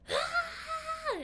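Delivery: sudden high frightened cry; quick pretend scream of fear; scared scream. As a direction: shriek like a woman in fright.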